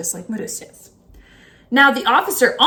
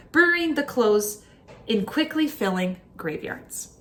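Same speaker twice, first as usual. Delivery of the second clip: plain reading voice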